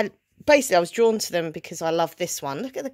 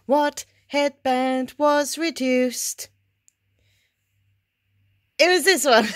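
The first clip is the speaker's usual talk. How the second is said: game show host; 7 semitones higher, 3 dB louder, slower than usual. Pretend. Game show style reveal.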